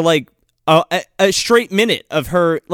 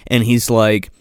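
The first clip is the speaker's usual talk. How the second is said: ordinary delivery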